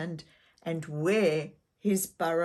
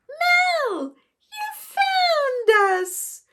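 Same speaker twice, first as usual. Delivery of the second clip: put-on very high, loud little girl's shout; little girl calling; 8 dB louder, much slower than usual. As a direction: call out happily as a small child. Little sister yells out.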